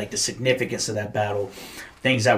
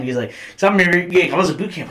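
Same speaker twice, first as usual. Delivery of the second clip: ordinary talk, nothing marked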